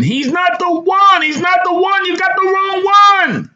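high, loud drawn-out scream; screaming bystander. A narrator's reading. Bystanders to the police over wrong man, screaming. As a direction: scream it out over the scene in protest. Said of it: "bystanders were screaming in unison".

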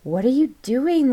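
high, loud acted shriek. A narrator's reading. Girl to friend opening the tomb, shrieked. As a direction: shriek it in alarm. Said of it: "Lindsay shrieked".

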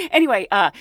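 ordinary talk, nothing marked

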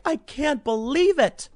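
high, drawn-out mock disbelief; mock outraged activist. Host mimicking progressive upper middle class activists, high mock outrage. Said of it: "activists going like".

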